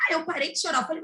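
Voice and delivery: usual speaking voice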